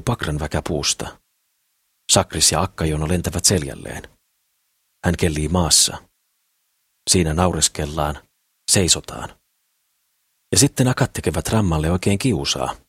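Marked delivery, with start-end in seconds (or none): none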